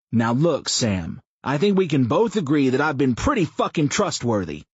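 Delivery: a foul-mouthed blowhard pitching an idea; crude loud man. A narrator's reading. Mouth pitches a rally to Sam, loud brash rough voice.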